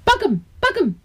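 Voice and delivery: put-on high eager kids' shout; boys shouting. A narrator's reading. Boys wanting to grab the bird, high eager calling. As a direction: call out like rowdy little boys. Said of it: "riepen een paar jongens".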